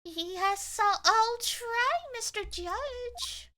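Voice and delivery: lightly sweet-talking, rising high; charming voice. Pretend. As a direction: coax the judge with mock charm. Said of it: "putting on the old charm".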